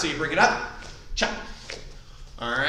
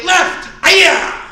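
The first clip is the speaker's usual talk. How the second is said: sharp high martial-arts yell; kiai shout; 10 semitones higher, 10 dB louder than usual. Shown as a demonstration. Instructor leading class drill, loud kiai shout. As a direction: shout the strike out from the gut.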